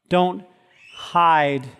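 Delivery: ordinary talk, nothing marked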